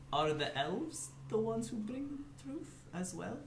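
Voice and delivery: timid question. Acted. Nervous in-character question.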